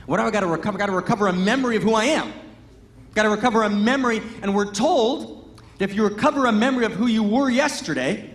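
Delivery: normal talking voice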